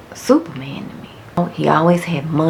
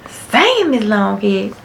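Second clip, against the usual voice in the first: high, louder playful dad; dad permitting; 6 semitones higher, 4 dB louder than usual. Retold or imitated. Dad letting his daughter curse, high and playful. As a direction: grant it with a grin.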